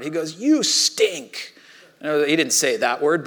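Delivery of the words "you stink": high-pitched mock outrage; furious friend. Angry surfer insulting his friend, high mock outrage. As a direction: hurl a retold insult, pitch way up. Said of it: "he looked furious".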